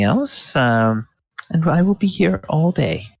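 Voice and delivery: high, giggly voice of a flattered manicurist; tittering manicurist. Acted. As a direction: bubble over with shy thanks. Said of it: "titters a little bit".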